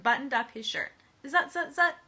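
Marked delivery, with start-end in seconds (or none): none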